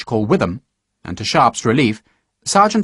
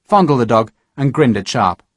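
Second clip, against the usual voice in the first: ordinary delivery, nothing marked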